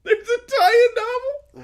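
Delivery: high, slow, cracking squeal of disbelief; shocked disbelief. Real, not acted. Friend hearing about a tie-in novel, squealing in disbelief. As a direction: wail it like you can't believe it.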